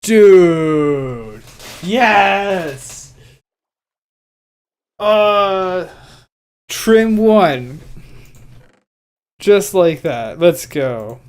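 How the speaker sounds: loud, high thrilled shouting; excited. Real, not acted. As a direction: cheer it out, overjoyed. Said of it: "I'm probably way too excited for that".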